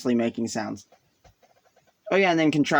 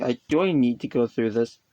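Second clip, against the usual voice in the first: usual speaking voice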